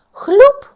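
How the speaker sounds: loud high splash sound effect; сплеск. A narrator's reading. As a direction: burst out the splash like water flying. Вода розхлюпалася. (гучне «хлюп» високим голосом)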